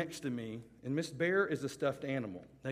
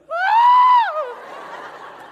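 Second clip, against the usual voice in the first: a sudden, very high, loud shriek; mock shriek of horror; 19 dB louder than usual. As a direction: shriek as if the girl's soul were ripped out. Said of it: "She looked at me and was like".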